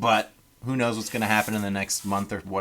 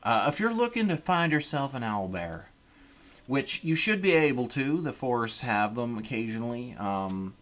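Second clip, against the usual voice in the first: put-on a Canadian's rough try at a Southern accent; Southern American accent. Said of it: "this is a Canadian trying to do a Southern American accent".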